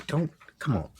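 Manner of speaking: ordinary delivery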